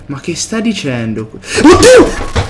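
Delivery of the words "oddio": high, loud "Oddio!" yelped in fright; scared gasp. Real, not acted. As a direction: yelp it as something jumps out. Sudden scare in horror game.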